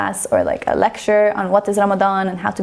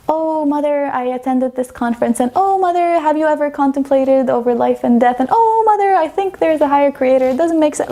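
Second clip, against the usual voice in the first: mock innocent hints; 6 semitones higher, 3 dB louder than usual. Dropping hints to her mom.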